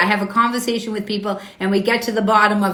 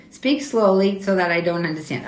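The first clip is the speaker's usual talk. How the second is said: ordinary talk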